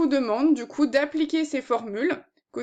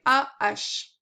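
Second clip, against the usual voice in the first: slowly spelled out. Shown as a demonstration. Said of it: "vous retenez ici la première syllabe".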